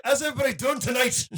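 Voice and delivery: high-pitched Scottish stage greeting; Scottish accent. Acted. Host playing the comic on stage, mock Scottish accent. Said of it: "Just be Scottish".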